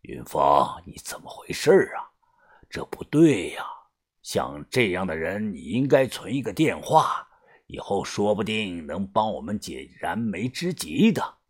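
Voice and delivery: hushed, low elder scolding; reproachful gang boss. Acted. Boss chiding Yunfeng over missing number, low reproach. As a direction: chide him softly, half on breath.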